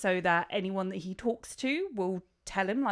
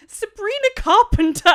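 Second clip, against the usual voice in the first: much higher and louder, a triumphant reveal; excited reveal; 13 semitones higher, 11 dB louder than usual. Real, not acted. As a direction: drop the name like a bombshell. Big reveal of surprise casting.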